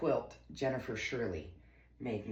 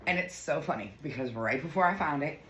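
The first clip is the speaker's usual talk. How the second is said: ordinary delivery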